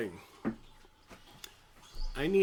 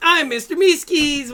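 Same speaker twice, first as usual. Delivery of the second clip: high, loud, squeaky cartoon shout; chirpy eager creature yell; Meeseeks voice; 14 dB louder than usual.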